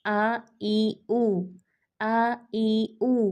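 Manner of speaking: आ ई ऊ drawn out very slowly with lips a finger apart; slow vowel practice; vowel drill. Shown as a demonstration. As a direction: stretch आ ई ऊ slowly, mouth barely open. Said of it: "इसकी practice के लिए".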